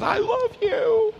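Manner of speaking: very high, slow, drawn-out mom voice; embarrassingly doting sing-out, hammed up; gushing mom. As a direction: imitate a mother gushing in public. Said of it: "My mom hugs me".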